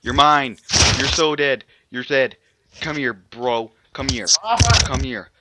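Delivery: loud taunting yells; aggressive shouting. Real, not acted. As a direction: chase him down, yelling. Attacking another player.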